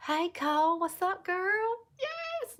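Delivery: high, drawn-out, delighted hello; excited greeting. Real, not acted. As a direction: greet a friend in a high, stretched squeal. Greets a viewer who joins live.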